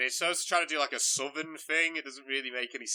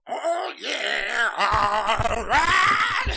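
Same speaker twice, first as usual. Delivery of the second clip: high, loud hammed-up "oh yeah, all right" with a laugh; laughing imitation of an over-the-top rocker; mock rock frontman; 11 semitones higher, 9 dB louder, much slower than usual. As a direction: ham up the rock frontman banter. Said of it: "he's like".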